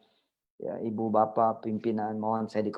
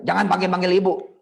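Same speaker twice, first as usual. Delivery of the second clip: mock scolding mother; 5 semitones higher, 5 dB louder, faster than usual. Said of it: "apa kata ibu kita?".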